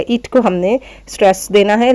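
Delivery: ordinary talk, nothing marked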